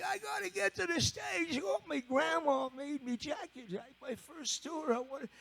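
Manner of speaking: high, pleading English voice. Retold or imitated. Naked drummer pleading to get to the stage, high English voice. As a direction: beg for the jacket like a frantic Brit. [name] voiced, desperate.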